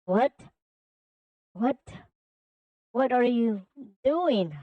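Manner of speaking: baffled, halting questions with rising pitch; mildly bewildered villain; confused villain. Acted. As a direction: ask as if thrown off balance. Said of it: "Infinite then became confused".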